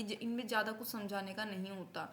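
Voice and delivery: usual speaking voice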